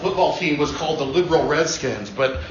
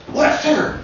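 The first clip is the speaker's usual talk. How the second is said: George W. Bush impression; 7 semitones higher than usual. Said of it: "he's a little raspy, but a little more Southern twang".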